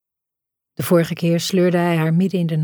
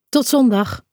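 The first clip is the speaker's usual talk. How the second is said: lightly called out, pitched higher; higher-pitched parting call; mild call; 6 semitones higher than usual. A narrator's reading. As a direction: call a goodbye on the way out.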